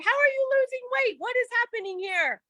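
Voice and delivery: high-pitched amazement at friends' weight loss; mock gasping how is this possible; shocked disbelief. Retelling own shocked question.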